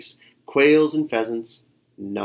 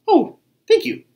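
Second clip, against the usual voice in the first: very high, loud delight; grateful cry; 9 dB louder than usual. A narrator's reading. Tiger thanks Bird for the song, crying out. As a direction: gush it out, voice shooting up. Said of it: "cried Tiger".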